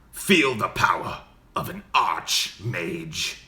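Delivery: slow, loud archmage with an edge; dark archmage. Shown as a demonstration. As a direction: proclaim power in a drawn-out, menacing mage voice. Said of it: "you gotta go a little darker".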